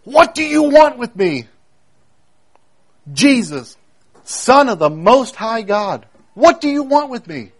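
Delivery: high-pitched cry, a mild version of shouting; retold shout. Preacher voices possessed man confronting Jesus, retold shout. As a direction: call it out like a desperate shout, held back. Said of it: "He shouted out at the top of his voice".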